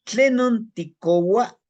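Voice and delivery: "Tlenon tikowa" slow, high and loud; slow Nahuatl demo. Shown as a demonstration. Teacher modeling "Tlenon tikowa" loudly for learners. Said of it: "teaching Nahuatl phrase".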